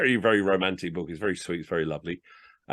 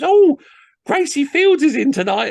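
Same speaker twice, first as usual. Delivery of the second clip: excited stagehand; 10 dB louder than usual. Retold or imitated. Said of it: "stage hands runs in and says".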